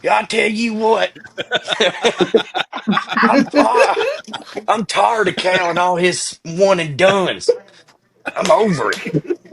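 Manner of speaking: high-pitched hammed-up Southern caller; kentucky accent. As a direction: play a riled-up Kentucky fan calling in. Caller impression on Kentucky radio.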